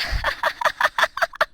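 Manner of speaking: very high, shrieking laugh; mocking laughter. Classmates laughing at Kamal in class, mocking. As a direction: cackle at a classmate's expense.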